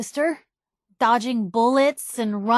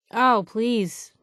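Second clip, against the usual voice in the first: normal talking voice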